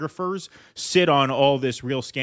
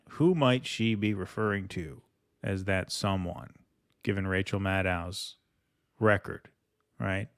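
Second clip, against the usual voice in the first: mock-conspiratorial hush; 6 semitones lower, 7 dB quieter than usual. Teasing the chat about Maddow.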